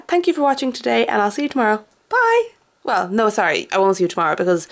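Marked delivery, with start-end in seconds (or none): 2.1-2.5 high, loud chirpy farewell; bright goodbye. Real, not acted. Cheerfully signing off the vlog to viewers. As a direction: fling out a cheerful bye.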